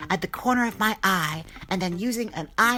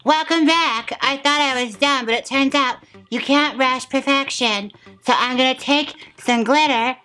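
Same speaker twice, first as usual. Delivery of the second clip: louder, stiff lips, face barely moving; stiff-faced talking; 3 semitones higher, 8 dB louder than usual. Real, not acted. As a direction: talk without moving the face, projecting. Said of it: "if you're wondering why I'm talking like this".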